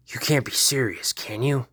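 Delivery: ordinary talk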